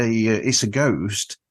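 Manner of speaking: ordinary talk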